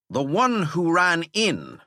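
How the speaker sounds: irritated schoolmaster; dry impatient teacher. Acted. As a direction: needle the breathless boy. Teacher echoes.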